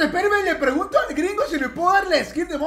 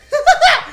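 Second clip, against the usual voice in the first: high, loud gloating cackle; mocking laugh; 11 semitones higher, 4 dB louder than usual. Real, not acted. Prankster hearing threats to report him, mocking laugh. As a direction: burst out laughing at their outrage. Troll laughs at demands for skin.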